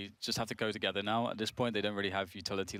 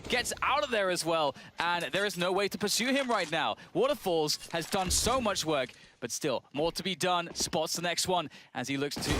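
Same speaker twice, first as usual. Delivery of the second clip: live play-by-play; 5 semitones higher than usual. Acted. Caster calling the A-site push live, excited play-by-play. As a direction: call the fight as it happens.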